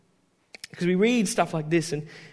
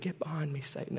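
softer, nearly muttered Get behind me Satan; quiet version; 3 semitones lower, 13 dB quieter than usual. Shown as a demonstration. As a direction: say it under your breath. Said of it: "You don't say that quietly".

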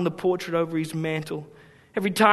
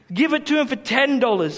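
mild retold shout; 6 semitones higher than usual. A narrator's reading. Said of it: "someone shouted".

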